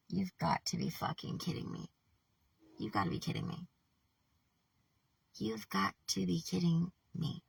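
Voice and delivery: barely voiced, stunned murmur; hushed disbelief. Real, not acted. Reader stunned by the next line, hushed disbelief. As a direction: say it softly, aghast. Stunned by what was just read.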